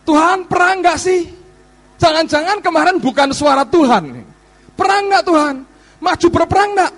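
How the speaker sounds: anxious pleading Israelites. Retold or imitated.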